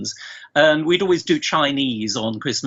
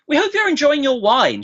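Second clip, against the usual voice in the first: much higher, sing-song caller voice; imitating the wine lady; 12 semitones higher, 3 dB louder than usual. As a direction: play the cheery phone lady again, pitched well up. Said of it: "she says".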